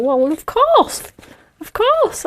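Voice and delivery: proud dad backing his son; imitating the dad. Dad proudly siding with his son, smug. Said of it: "his dad went".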